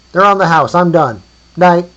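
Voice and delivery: high, loud sign-off; calling across distance. A narrator's reading. Giving bones away for free, calling out. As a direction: holler it over and be done. Answering the buyer from afar.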